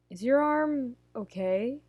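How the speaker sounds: a touch awkward, slow and hesitant. A narrator's reading. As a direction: ask it a little awkwardly, taking your time. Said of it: "It sounds awkward even to his own ears".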